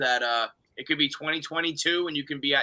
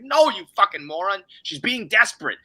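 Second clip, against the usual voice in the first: higher, louder annoyed snap; mock-snap it back at the fool; exasperated retort; 5 semitones higher, 5 dB louder than usual. The queen answering the king, a higher, louder mock snap. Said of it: "He's like".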